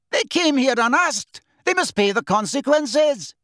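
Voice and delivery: high accusing shout; angry shout. A narrator's reading. Bearded centaur from back of crowd, shouting. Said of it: "toward the back of the crowd shouted".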